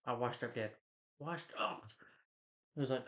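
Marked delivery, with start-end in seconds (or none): 1.2-1.8 higher, softer, rising at the end; mopey echo. Retold or imitated. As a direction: echo it back higher and softer, half asking. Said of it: "He's like".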